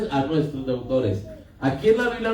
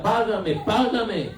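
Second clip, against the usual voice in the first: higher, insistent demand; demanding payment; 8 semitones higher, slower than usual. Acted. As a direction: press him hard to pay up. Master harasses the servant for payment.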